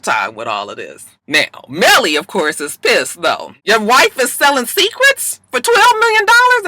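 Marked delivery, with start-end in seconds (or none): 3.6-6.7 high, loud outraged squawk; indignant president. Retold or imitated. President shrieking at the husband in outrage. Melly pissed, retold outrage.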